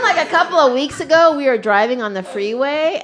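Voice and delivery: usual speaking voice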